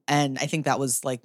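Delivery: ordinary talk, nothing marked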